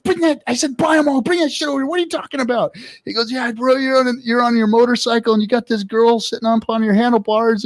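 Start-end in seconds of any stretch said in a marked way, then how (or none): 0.0-2.7 high-pitched, worked-up retold reply; urgent excitement. Telling buddy to buy them all, urgently excited. 3.3-7.6 raised, eager retold buddy voice; excited explaining. Buddy describing the video cover, excited.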